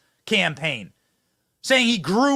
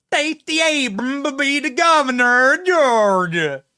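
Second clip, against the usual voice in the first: slow, higher mocking put-on Southern drawl; mock fake Southern accent; 6 semitones higher, 5 dB louder than usual. Host mocking Kamala Harris's fake accent, slow Southern drawl. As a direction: parody a politician faking a Southern accent, slowly. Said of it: "the Kamala Harris fake accents".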